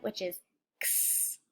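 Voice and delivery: x as a breathy unvoiced ks hiss; x sound. Shown as a demonstration. Teacher modelling the letter sound for kids, a whispered ks. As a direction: make x as a soft k then s, no voice. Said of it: "the sound it can represent".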